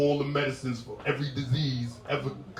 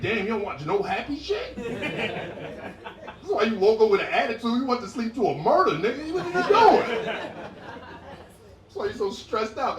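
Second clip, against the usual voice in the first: mock scolding; 9 semitones higher, slower than usual. Comic scolds woman over murder shows, high incredulous rant. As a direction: scold in climbing disbelief.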